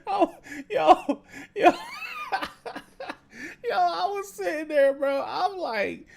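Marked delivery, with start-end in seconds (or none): 0.1-1.7 high, loud laughing words; cracking up at the water bill. Real, not acted. Laughing at his own story. 1.8-3.1 high laugh trailing down; laughing at the water bill; high falling laugh. Real, not acted. Laughing at his own story.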